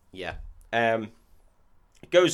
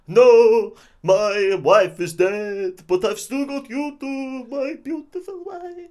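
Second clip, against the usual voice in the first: high, slow, singsong hammy voice; singsong Dracula; 12 semitones higher, 8 dB louder, much slower than usual. Retold or imitated. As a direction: sing the lines like an overacting vampire. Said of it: "I'm gonna do Dracula very singy".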